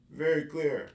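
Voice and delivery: dry ironic "very clear"; sarcastic. Real, not acted. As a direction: say it like it was anything but clear. After rereading confusing textbook.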